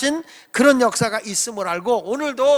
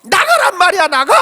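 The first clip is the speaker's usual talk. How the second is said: shouting; 8 semitones higher, 8 dB louder than usual. Retold or imitated. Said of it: "이렇게 외치는 거예요".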